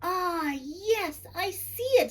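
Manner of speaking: a bright sheep spotting a shape in the stars; excited sheep voice. A narrator's reading. Sheep gasping at the night sky. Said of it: "said Daisy".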